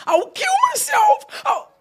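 mock sobbing scream of a girlfriend with a knife; crying threat. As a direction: wail it like a hysterical woman. Woman threatening to stab herself.

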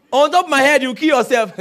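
boyfriend's loud mock dare to the girlfriend; mocking retort. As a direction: throw it back at her loudly.

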